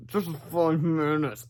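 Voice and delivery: very slow, soft half-asleep moan; sleepy moan. A narrator's reading. Harold to George from the desk, slow sleepy moan. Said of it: "moaned Harold".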